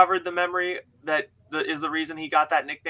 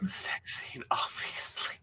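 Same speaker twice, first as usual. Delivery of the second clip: breathy conspiratorial whisper; teasing hushed aside; mock whisper; 12 dB quieter than usual. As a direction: lean in and whisper it slyly. Sly aside to chat.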